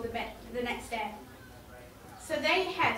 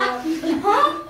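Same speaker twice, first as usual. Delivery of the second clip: loud voice; 3 semitones higher, 11 dB louder than usual. Pretend. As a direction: yell it like you're hard of hearing. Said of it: "saying in a loud voice".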